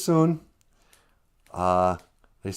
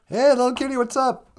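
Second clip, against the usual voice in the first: much higher, bright skunk greeting; cheery skunk; 6 dB louder than usual. Acted. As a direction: call out happily to an old friend.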